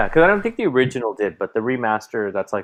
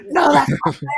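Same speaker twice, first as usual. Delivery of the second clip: put-on whiny kid protest. Quoting son's protest.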